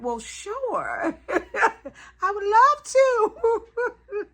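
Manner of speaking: high, giggling, stretched-out yes; laughing acceptance. Retold or imitated. Vlogger retelling her giddy reply to the brand, laughing. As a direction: gush through giggles, slowly. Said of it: "I said".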